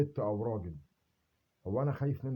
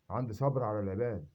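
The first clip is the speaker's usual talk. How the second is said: ordinary talk, nothing marked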